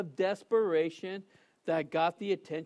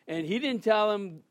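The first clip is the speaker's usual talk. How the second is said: ordinary talk, nothing marked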